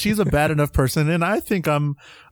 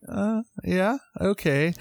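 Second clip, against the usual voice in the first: hesitant, higher-pitched agreement; awkwardly agreeing to avoid correcting someone; going along; 6 semitones higher, 4 dB quieter, much slower than usual. Acted. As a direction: play along uncertainly in a raised pitch. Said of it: "just kinda going along with it".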